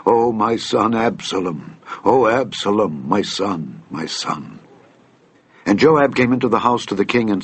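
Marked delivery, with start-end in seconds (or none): none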